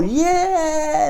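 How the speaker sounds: high, bubbly American yeah; gushing yeah. Retold or imitated. Teacher mocking American optimism with a high gushing yeah. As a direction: squeal it like an excited guide. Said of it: "esa emoción, ese optimismo".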